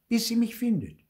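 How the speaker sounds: high-pitched, mildly anxious inner voice; anxious thought. A narrator's reading. Theo waiting for Mama to find him, anxiously hopeful. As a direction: think it high, clinging to hope. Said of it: "denkt Theo und sieht ängstlich".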